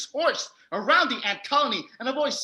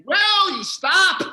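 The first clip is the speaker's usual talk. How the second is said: exasperated outburst; 10 semitones higher, 9 dB louder, slower than usual. Real, not acted. Narrator annoyed at interruptions.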